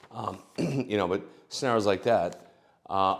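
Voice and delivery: usual speaking voice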